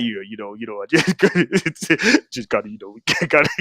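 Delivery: low voice breaking into laughter; deep voice. Shown as a demonstration. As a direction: try deep but crack up. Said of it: "my voice is high".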